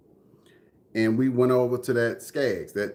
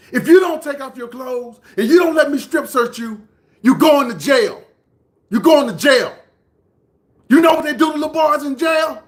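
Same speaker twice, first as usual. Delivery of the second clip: high, loud imitation of a threatening man; escalating mock yell; retold shouted threat; 13 semitones higher, 9 dB louder than usual. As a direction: act out intimidating kids at full volume.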